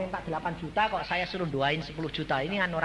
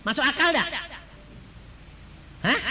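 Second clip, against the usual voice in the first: normal talking voice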